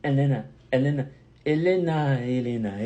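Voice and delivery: Atlanta chanted loud as "Ellenna"; local Atlanta pronunciation. Shown as a demonstration. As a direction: hammer out Atlanta with the t's dropped, over and over. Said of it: "je dis Atlanta".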